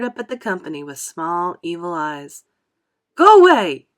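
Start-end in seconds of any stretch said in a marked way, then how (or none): 3.1-3.9 much higher, much louder yell; shout. A narrator's reading. Creatures shouting at the shark together. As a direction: scream it at the shark. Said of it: "they shouted".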